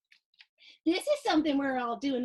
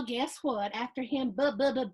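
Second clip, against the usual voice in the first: usual speaking voice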